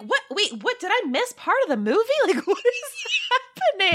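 high, incredulous replay of bafflement; mock confusion. Viewer spots flipping helicopter, high mock confusion. Acts out own confused reaction.

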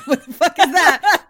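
loud, laughing disbelief; laughing mock outrage. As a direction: blurt it out through laughter. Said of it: "people would be like".

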